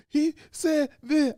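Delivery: put-on whiny kid. Father mimicking his crying kid tattling, whiny. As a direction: whine it like a tattling child.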